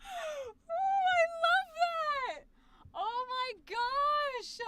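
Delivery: very high, drawn-out gushing; squealing delight. Real, not acted. Streamer reacting to the edited audition, squealing delight. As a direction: squeal it slowly, overcome with delight.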